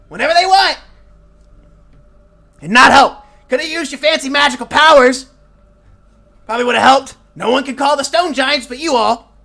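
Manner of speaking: put-on high, loud grumpy old gnome scolding; a crotchety gnome hollering at the adventurers for showing up late; cranky old gnome yelling. Mort rants at the party.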